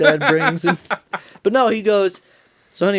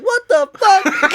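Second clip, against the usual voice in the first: high, slow, drawn-out disbelief; shocked what the fuck; 14 semitones higher, 4 dB louder, much slower than usual. Retold or imitated. Son reacting to dad handing him cocaine, shocked and drawn out. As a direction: stretch it out in stunned alarm.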